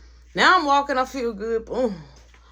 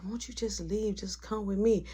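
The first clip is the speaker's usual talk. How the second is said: hushed plea; 9 dB quieter than usual. Retold or imitated. Coworker urging quietly.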